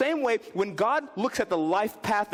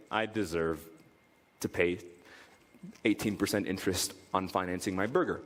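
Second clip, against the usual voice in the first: mock resignation; 11 semitones lower, 4 dB quieter than usual. Sarcastic low self-blame.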